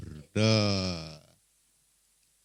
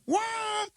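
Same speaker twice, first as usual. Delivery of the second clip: thin, very high mock yell; mock scream; 3 dB quieter than usual. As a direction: scream early, without real volume. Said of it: "waktu tiga dia teriak". (teriakan pura-pura bernada sangat tinggi)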